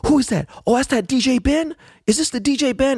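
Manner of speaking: very high-pitched agitated intruder voice; high squealed mock disbelief; intruder's outburst. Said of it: "was like".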